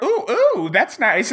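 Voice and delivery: very high, hammed-up ooh of pleasure; squeaky mock delight. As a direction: pretend the rack feels good. Voicing tortured people enjoying it.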